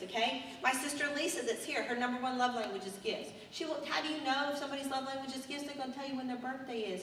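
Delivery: normal talking voice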